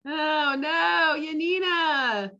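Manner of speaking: drawn-out amused groan. Real, not acted. Host reacts to meme costume.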